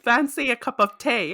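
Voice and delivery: put-on posh British accent. Hostess asks guests in an overdone posh British accent. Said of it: "narrow view of what a British person talks like".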